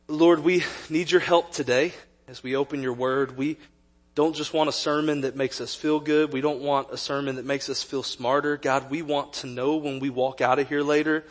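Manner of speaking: soft, low prayer. Real, not acted. Pastor praying for the church before the sermon, soft and earnest. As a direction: pray quietly and sincerely.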